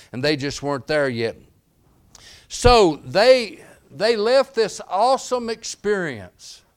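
loud, high, driving sermon voice; fiery pulpit preaching; preaching at full force. Acted. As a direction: preach it out to the whole church.

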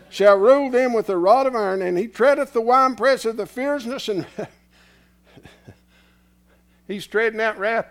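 loud, high scripture declaiming; preaching scripture. Acted. Preacher proclaiming Revelation to the congregation, loud. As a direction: proclaim the verse.

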